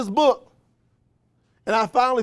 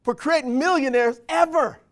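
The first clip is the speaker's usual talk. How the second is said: higher, louder incredulous wondering; wondering aloud; 6 semitones higher, 4 dB louder than usual. Retold or imitated. Speaker recalls wondering why the book isn't taught, raised and amazed. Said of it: "I used to wonder".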